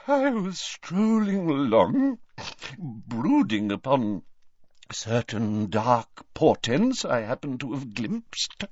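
high, slow, dreamy seer voice; tipsy mystic seer. Acted. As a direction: drift through it like a vague prophetess.

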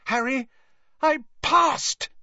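high, delighted announcement; thrilled at passing her test. Acted. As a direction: burst out with the good news. Bursting in with exam news.

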